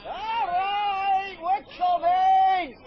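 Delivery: loud, high call to friends far off; a high, long, loud halloo to distant friends. Acted. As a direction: shout it across the moor, drawn out. Calling out to the others.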